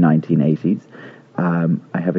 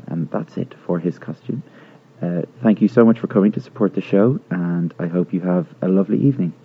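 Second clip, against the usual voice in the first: normal talking voice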